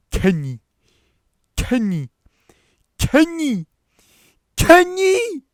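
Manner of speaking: shouts climbing to a high scream; escalating manic yells in a strange voice; repeated shouting. Acted. Man in the back shouting over and over, building scream. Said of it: "he was shouting".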